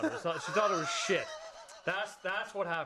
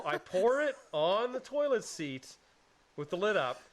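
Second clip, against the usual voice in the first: normal talking voice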